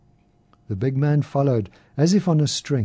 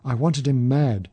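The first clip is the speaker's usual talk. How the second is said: ordinary delivery, nothing marked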